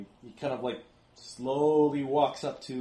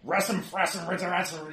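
cartoon cursing in gibberish, higher and sputtering; Yosemite Sam; 4 semitones higher than usual. Game master imitating the creature's babble, cartoon grumbling. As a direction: grumble nonsense swear words like an angry cartoon character. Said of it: "it's Yosemite Sam's speech".

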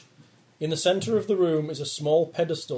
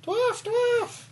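high-pitched cry of dwarf, dwarf; squeaky high call. Acted. Calling out in high voice.

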